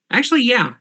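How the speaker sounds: sudden realization. Real, not acted. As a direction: it just clicked.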